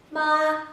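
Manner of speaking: "Ma" high and level; first tone. Shown as a demonstration. Teacher modelling for repetition, "Ma" high and even. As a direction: hold "Ma" on one high note. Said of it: "Repeat after me".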